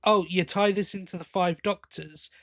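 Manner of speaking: ordinary delivery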